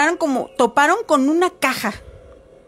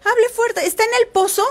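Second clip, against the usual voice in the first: put-on high, raised kids' voices calling; children calling out; 10 semitones higher, faster than usual. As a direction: call down urgently to the buried woman. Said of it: "a decir".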